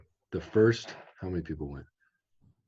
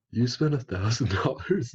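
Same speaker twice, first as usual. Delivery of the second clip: higher, incredulous mock question; retold disbelief; 7 semitones higher, 4 dB louder than usual. Friends hearing about the thousand dollars, acted disbelief. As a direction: echo the question in acted astonishment. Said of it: "we were just like".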